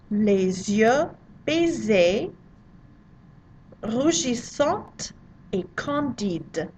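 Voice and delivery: "Les yeux baissés, rougissante et candide" said slowly in careful French; slow French model reading. Shown as a demonstration. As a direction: model each French word slowly for students.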